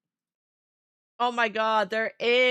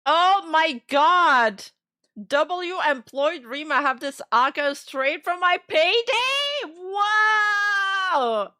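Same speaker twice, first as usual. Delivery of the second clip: excited shouting; 4 semitones higher, 5 dB louder, slower than usual. Real, not acted. Reacting to large donation.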